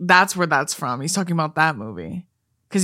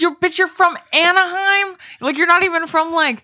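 much higher, retold disbelieving rant; incredulous rant; 11 semitones higher, 3 dB louder than usual. Friend retelling what she told the others, incredulous. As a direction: replay your own outrage. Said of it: "I said".

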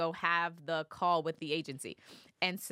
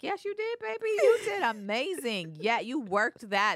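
acted proud parent hyping up a child; gushing praise; 4 semitones higher, 4 dB louder than usual. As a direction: lay on the encouragement, stretching key words. Retells hyping up her daughter.

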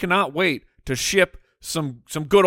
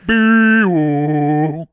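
dolphin noise; 7 dB louder than usual. Pretend. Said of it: "Imagine a dolphin".

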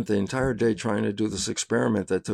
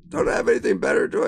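amused inner voice; 12 semitones higher, much faster than usual. Retold or imitated. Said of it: "my mind started to laugh".

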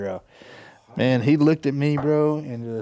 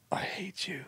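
retold whisper, breathy and unvoiced; whispered hate; 6 semitones higher, 16 dB quieter, slower than usual. Swim buddy whispering to his classmate, bitter whisper. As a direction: hiss it under your breath like a secret grudge. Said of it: "and he was like".

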